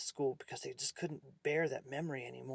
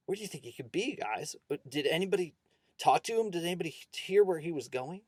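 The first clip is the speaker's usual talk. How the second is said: higher, agitated string of questions; nervous; 4 semitones higher, 6 dB louder than usual. A narrator's reading. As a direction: question the class with rising worry. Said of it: "but also visibly nervous".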